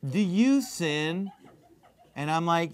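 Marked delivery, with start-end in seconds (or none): 0.1-1.3 slow, drawn-out impression of a radio host; stretched-out mocking imitation of a talk host; Jesse Lee Peterson. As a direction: mimic the interviewer, dragging each word out. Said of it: "this is how he talks".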